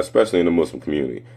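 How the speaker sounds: ordinary talk, nothing marked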